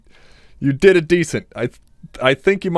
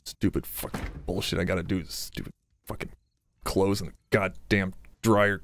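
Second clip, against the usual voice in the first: resentful mutter to yourself; grumbling mutter; 8 semitones lower, 10 dB quieter than usual. Real, not acted. Stuck fetching soda for a game character, grumbling under breath. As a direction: grumble it low under your breath, half swallowed. Grumbling to himself about chores.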